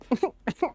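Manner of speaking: high, stuttering mock infant wail starting up; baby crying build-up. Shown as a demonstration. Player showing off a tiny infant cry, mock crying build-up. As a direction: imitate a baby working up to a cry. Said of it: "the build-up when they're crying".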